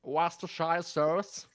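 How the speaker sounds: slow, over-the-top American accent; exaggerated American accent. Shown as a demonstration. Asked for their best American accent, exaggerated American accent. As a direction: stretch it out in a hammed-up American accent. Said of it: "Can you do your best American accent?".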